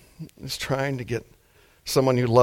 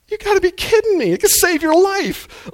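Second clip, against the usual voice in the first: high, loud exasperated plea; emotional outburst; 5 dB louder than usual. Shown as a demonstration. Preacher exasperated at people fearing the MRI, high outburst. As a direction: burst out in disbelief, pitched way up. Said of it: "another emotional outburst".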